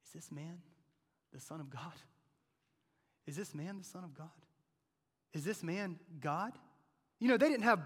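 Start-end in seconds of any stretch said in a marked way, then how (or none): 0.0-6.6 soft, awed questioning; quiet, searching musing; hushed wondering. Acted. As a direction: ask it quietly, half to yourself. Scribes' imagined wondering.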